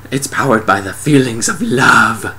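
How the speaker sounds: drawn-out gushing on love; hammed-up romantic revelation; mock sentimental. Explaining red light, mock sentimental.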